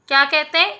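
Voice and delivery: ordinary talk, nothing marked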